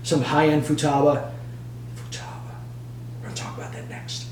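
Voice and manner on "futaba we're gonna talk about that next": secretive hushed preview; whispered aside. Acted. Teasing viewers about Futaba next, whispered. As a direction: whisper the brand like a teaser.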